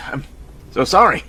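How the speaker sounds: normal talking voice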